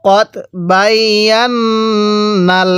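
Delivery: কদ বাইয়ান্নাল with a long held n; measured model reading of কদ বাইয়ান্নাল; slow tajweed recitation. Shown as a demonstration. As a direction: stretch the doubled n in বাইয়ান্নাল. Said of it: "কিভাবে পড়ব?".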